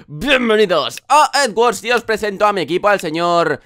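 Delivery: loud, high, upbeat channel greeting; YouTuber intro. Acted. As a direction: hype up the viewers.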